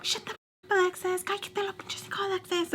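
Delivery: very high, softened mock scolding; retold mad outburst. Storyteller as someone getting so mad at Alexis, high mimicked scolding without volume. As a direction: mimic her telling someone off, squeakily high. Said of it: "just getting so mad".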